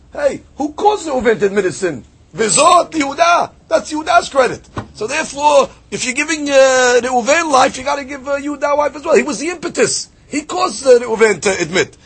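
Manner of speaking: rising preacher's fire; fervent preaching. Acted. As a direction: build to a passionate climax.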